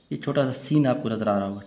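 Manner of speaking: ordinary talk, nothing marked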